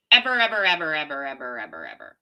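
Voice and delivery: ever repeated, dying away into a far echo; a mock echo trailing off into silence; fading echo. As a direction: let each ever fade out like an echo.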